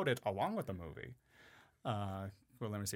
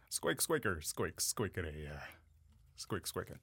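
squirrel talk; 5 semitones lower than usual. Pretend. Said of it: "talk to me in squirrel".

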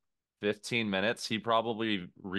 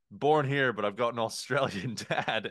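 fake Australian accent; 2 semitones higher than usual. Waiter justifying his fake Australian accent. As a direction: imitate a waiter insisting he's Aussie. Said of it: "definitely a fake Australian accent".

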